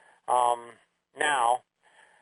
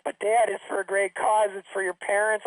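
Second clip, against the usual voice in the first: lightly voiced kid coaxing Dad, pitched up; higher, mildly wheedling imitation of a daughter; pleading daughter; 5 semitones higher than usual.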